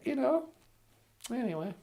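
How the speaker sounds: ordinary talk, nothing marked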